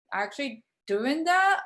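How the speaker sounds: normal talking voice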